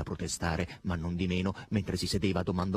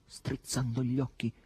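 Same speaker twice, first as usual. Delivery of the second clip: plain reading voice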